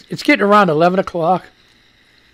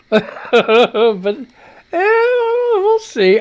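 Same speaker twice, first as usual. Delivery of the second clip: laughing doubt; 11 semitones higher, 4 dB louder, much slower than usual. Real, not acted. Laughing doubt after tasting.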